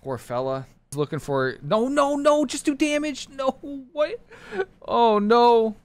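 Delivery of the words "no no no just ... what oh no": high, loud frantic no's; panicked yelling. Real, not acted. Gamer panicking as teammate's play fails. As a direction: cry out in alarm.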